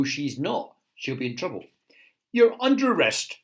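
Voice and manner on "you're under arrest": loud, raised arresting order; soldier. A narrator's reading. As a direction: announce it firmly as a guard. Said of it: "said the soldier".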